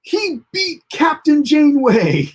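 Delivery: hammed-up Pakled wonder; awed Pakleds. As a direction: gasp it like dim aliens in awe. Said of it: "they're like".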